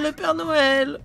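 high elf voice with a giggle; a giggling little elf. Acted. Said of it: "une voix un peu plus aiguë".

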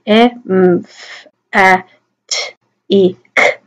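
phonemes of emphatic, each isolated; "E, M, F, A, T, I, K" as speech sounds; sounding out. Shown as a demonstration. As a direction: separate sounds with small gaps. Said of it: "Do it again".

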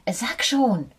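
hohes, drängendes Flüstern; flüstere ungeduldig; drängelnd. A narrator's reading. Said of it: "Jetzt drängelte sie". (die Maus drängt Fox flüsternd)

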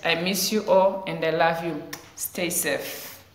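usual speaking voice

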